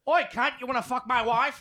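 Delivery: loud, high hammed-up Aussie bloke; Australian accent. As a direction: shout it like a rowdy Australian in a pub. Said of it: "can you do Australian?".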